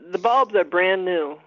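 slowed down. Real, not acted. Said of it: "Can you slow down a little bit there".

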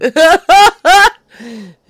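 high, very loud real laughter; explosive laughing fit; loud cackle. Real, not acted. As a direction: burst into a big high cackle. Laughing at caller's kid-like voicemail.

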